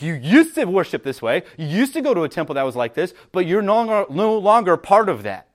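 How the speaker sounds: ordinary talk, nothing marked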